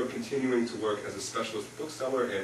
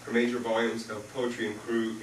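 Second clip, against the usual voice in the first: ordinary talk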